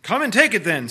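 loud bellowing challenge. A narrator's reading. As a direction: roar it out like a bull-man. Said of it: "in a great bellowing voice".

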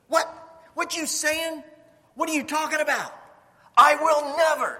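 high, loud protest; shocked disbelief, rising to a loud cry; indignant Peter. Acted. Peter protesting vehemently, acted.

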